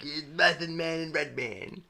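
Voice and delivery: high, slow announcer voice; commentator. Retold or imitated. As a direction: announce it like a sportscaster. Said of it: "the commentators like".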